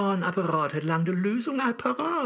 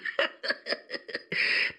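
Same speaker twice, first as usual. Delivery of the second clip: devilish laugh; 3 dB quieter than usual. Acted.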